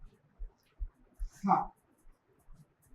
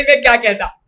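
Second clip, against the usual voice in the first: usual speaking voice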